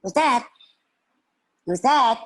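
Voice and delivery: put-on high, loud child's call; startled little girl. Little girl hearing a noise, startled. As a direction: call out to find who is there.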